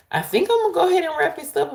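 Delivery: high and fast, rushing; breezy high-speed wrap-up; quick excited aside. Real, not acted. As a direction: rattle it off in a high rush.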